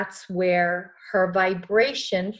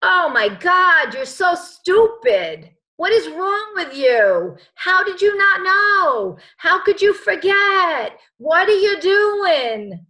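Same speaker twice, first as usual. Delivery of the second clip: higher, louder, slow scolding inner critic; harsh ego voice; 8 semitones higher, 7 dB louder, slower than usual. Acted. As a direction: berate yourself like a nagging ego. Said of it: "the ego goes".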